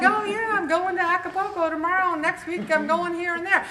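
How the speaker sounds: high, fast, carefree; mock breezy vacationer. Group member bragging about trips, breezy mock voice. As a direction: toss it off like a jet-setter. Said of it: "They're like".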